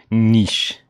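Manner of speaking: "niche" said as "neesh"; French pronunciation. Shown as a demonstration. Co-host calling the word pretentious, French-style "neesh". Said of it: "niche always sounds pretentious".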